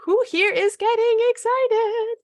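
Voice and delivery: high, loud hype-up call; excited hype. Real, not acted. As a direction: pump up the crowd. Hyping up the audience.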